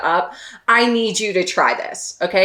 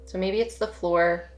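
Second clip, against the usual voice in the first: soft, unhurried meditation voice with long pauses; hushed, spacious meditation guidance; guided meditation; 2 semitones lower, 8 dB quieter than usual. Real, not acted. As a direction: gently guide them to settle and notice, leaving space between phrases.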